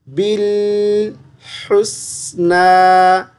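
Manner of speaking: slow melodic tilawat chant; Quran recitation. Shown as a demonstration. Teacher recites the verse for the student, slow tilawat. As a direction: recite it slowly, drawing out each vowel.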